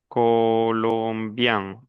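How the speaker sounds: very slow, syllable-by-syllable "colombiens"; slow modelling. Shown as a demonstration. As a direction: draw out every syllable of "colombiens" for the student. Teacher models plural form slowly. ("colombiens" muy lento, sílaba por sílaba)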